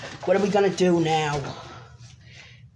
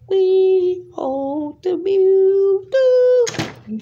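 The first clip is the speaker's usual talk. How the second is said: 1 high drawn-out character voice; 12 semitones higher, 5 dB louder, much slower than usual. Acted. Odd character voice proclaims.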